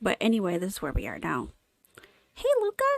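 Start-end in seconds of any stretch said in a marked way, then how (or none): 2.3-2.8 put-on high, bright-eyed young girl greeting; high girl character voice. Girl reporter Dawn startling Luca, high kid voice. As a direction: pitch way up as a kid sneaking up.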